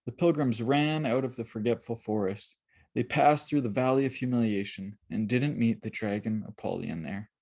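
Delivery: plain reading voice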